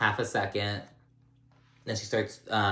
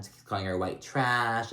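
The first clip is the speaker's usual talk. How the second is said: ordinary talk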